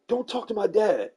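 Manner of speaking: put-on high, loud, laughing teen snap; bratty daughter. Camille telling neighbor kids off, high loud snap. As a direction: play the irritated teenager, loud and high.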